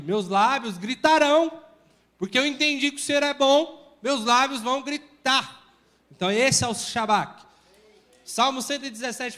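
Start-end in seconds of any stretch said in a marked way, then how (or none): 0.0-5.6 proclaiming loudly. Shown as a demonstration. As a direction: proclaim it like a shout of praise. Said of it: "Mas o que que tá falando aqui".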